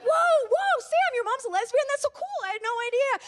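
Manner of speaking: high, loud excited crowd voices; hyped-up crowd impression. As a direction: gush like a thrilled audience.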